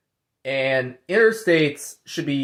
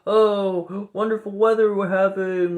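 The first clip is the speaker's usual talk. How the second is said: generic NPC voice; 5 semitones higher than usual. Retold or imitated. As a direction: parrot a canned NPC line, a bit higher. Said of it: "N P Cs are gonna say generic things like".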